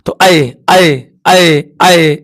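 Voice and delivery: ऐ repeated slowly, each vowel held clearly; sounding out vowel. Shown as a demonstration. Teacher models ऐ for students, held vowel. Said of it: "मैं इसका उच्चारण करता हूं".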